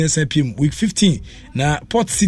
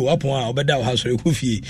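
normal talking voice

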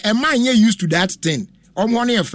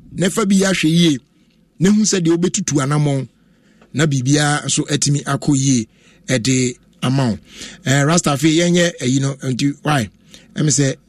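normal talking voice

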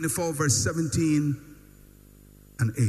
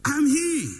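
higher-pitched mock proclamation; mock self-announcement; 7 semitones higher, 4 dB louder than usual. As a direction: declare yourself as if making an entrance. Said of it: "just say".

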